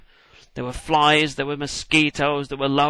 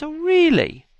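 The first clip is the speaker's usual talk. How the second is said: mock surprise; 6 dB louder, slower than usual. Pharaoh mocks Moses's claim.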